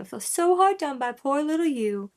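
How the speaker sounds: high, exaggerated mock pity; sarcastic. Reactor mimicking the rapper's jab, sarcastic. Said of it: "That sarcasm, that's, that's very Southern".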